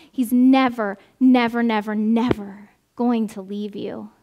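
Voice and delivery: preacher hammering "never" for the congregation; emphatic nevers. Acted. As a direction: press every "never", then soften the end. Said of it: "emphatic negations".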